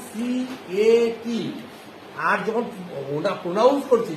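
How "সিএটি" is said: সিএটি as three slow separate letter names, C, A, T; spelled letter by letter. Shown as a demonstration. Teacher shows how spelling goes, slow separate letters. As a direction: space out সিএটি as see, ay, tee. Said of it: "বানান করার সময় পড়লাম".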